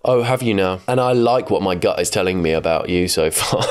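usual speaking voice

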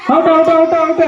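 loud, repeated referee's call; rapid-fire shouted ruling. Real, not acted. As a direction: announce it loudly over the crowd. Calling the player out.

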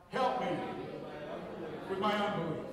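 congregation repeats "Help me with my unbelief" loudly; loud call and response. Real, not acted. Congregation echoing the pastor, loud and together. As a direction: shout "Help me with my unbelief" back to the preacher. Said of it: "Would you repeat that after me?".

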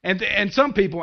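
ordinary delivery

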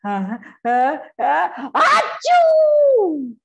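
building uh uh into a huge loud achoo; big sneeze. Acted. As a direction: wind up and explode into an elephant sneeze. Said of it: "And Gajapati Kulapati said".

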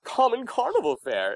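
high, haughty disdain; arrogant pansy. Acted. Actor recalling a pansy prince's lines, arrogant disdain. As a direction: sneer it like a pampered prince. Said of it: "in that kind of attitude".

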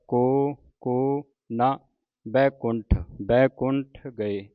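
ਕੋ ਕੋ ਨਾ slowly, each word apart; slow demonstration, ਕੋ ਕੋ as two separate words; separated words. Shown as a demonstration. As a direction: leave a gap between ਕੋ and ਕੋ, then ਨਾ. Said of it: "ਇਸ ਤਰ੍ਹਾਂ ਉਚਾਰਨ ਕਰਨਾ ਹੈ".

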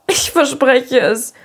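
slow, tearful vow; understated sob, drawn out; sobbed promise. A narrator's reading. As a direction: promise it through held-back sobs. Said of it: "schluchzte Diana".

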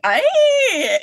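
long, high, loud Scottish "Aye"; terrible Scottish accent. Retold or imitated. Player answering another's terrible Scottish, long high mock-Scottish cry. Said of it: "in response to his terrible Scottish".

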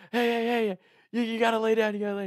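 high-pitched, hurried placating voice; imitated urgent pleading. Friends telling staggering drunk to lie down, high-pitched pleading. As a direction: mimic small guys trying to settle a big drunk.

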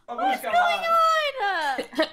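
high, slow laughing cry; laughing disbelief. Real, not acted. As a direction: laugh it out stunned. Laughing shock at bodies.

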